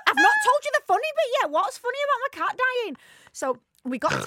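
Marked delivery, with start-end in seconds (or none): 0.1-3.0 high, fast and loud; excited. Real, not acted. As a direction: rush it out laughing. Laughing at own story.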